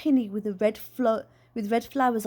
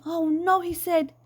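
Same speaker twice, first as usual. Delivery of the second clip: flinching dismay; 8 semitones higher than usual. A narrator's reading. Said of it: "Dad flinched".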